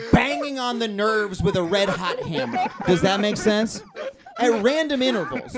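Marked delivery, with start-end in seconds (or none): none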